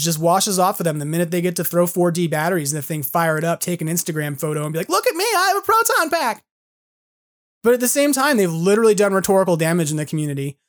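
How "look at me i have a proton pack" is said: high-pitched mock fan showing off; mock gushing fan. Collector posting his proton pack photo, mocking high voice. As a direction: parody a fan bragging about his new toy.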